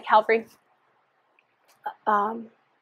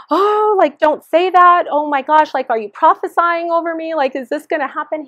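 retold tearful panic; 6 semitones higher, 4 dB louder than usual. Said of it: "I was crying".

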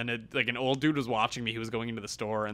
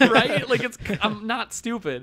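high, loud "Right?" then laughing through a slower "I'm not stupid"; incredulous laugh-rant; laughing indignation; 9 semitones higher, 7 dB louder, slower than usual. Real, not acted.

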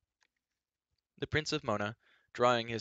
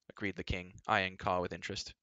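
usual speaking voice

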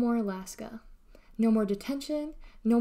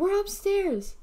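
high, raised holler; calling back; 11 semitones higher, 5 dB louder, slower than usual. A narrator's reading. R B answers his mother from upstairs, calling. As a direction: shout it down the stairs.

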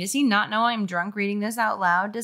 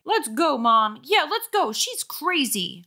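put-on higher kid voices; scared boys; 7 semitones higher than usual. A narrator's reading. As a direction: hurry mom off. Boys pull mother away.

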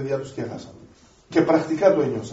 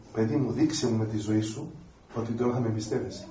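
tender fatherly voice; 9 semitones lower, 10 dB quieter than usual. Acted. God the Father speaks to child.

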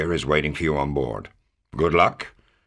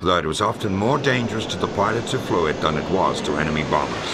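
newsreel narration. A narrator's reading. Narrator telling viewers about Nazi jets, newsreel style. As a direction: announce it like an old newsreel. Documentary narration over music.

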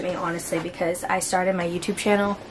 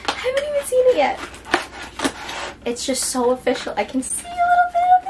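teary squeal; faster than usual. Real, not acted. Teary excitement opening the box.

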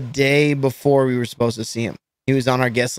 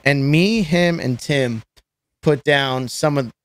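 ordinary talk

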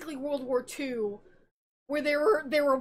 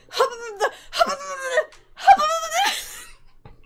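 mock scream; 12 semitones higher, 7 dB louder than usual. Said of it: "literally she's like".

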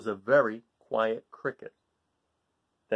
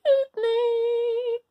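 very high, loud buzzing tone; thin high insect buzz; buzzing mosquitoes; 5 dB louder than usual. Acted. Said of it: "buzzed the mosquitoes".

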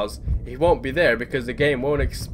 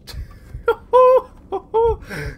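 high, panicky laughing wail; nervous shrieks, half laugh, half cry; 9 dB louder than usual. Real, not acted. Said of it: "I'm screaming like a little baby".

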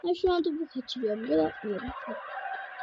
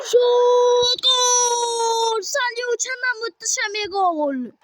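sudden loud goal yell, much higher; screaming football commentator; 11 semitones higher, 8 dB louder, much slower than usual. Acted. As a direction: explode on the shot and goal.

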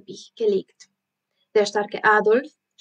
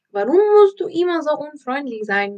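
higher, louder and fast, full of reproach; reproachful; 5 semitones higher, 4 dB louder, faster than usual. Acted. Pippi reproaching the grumpy circus director, higher and fast. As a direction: scold him for being so rude. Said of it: "sagte Pipi vorwurfsvoll".

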